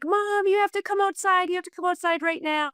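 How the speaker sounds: high, urgent, fast mock panic; panicked daughter. Mother imitating her daughter's panicked call. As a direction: play the frantic teen calling mom. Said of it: "Grace called me in panic".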